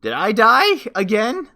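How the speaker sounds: slow, high, loud questioning; baffled disbelief. Real, not acted. Player asking if the character died, baffled.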